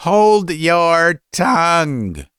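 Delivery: high, slow, drawn-out shout; father furiously silencing his son; angry cry. A narrator's reading. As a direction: cry it out, stretching every word. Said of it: "cried the father".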